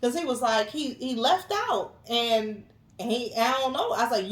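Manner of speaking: usual speaking voice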